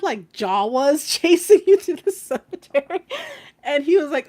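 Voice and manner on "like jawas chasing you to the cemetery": laughing. Real, not acted. Teasing friend, laughing.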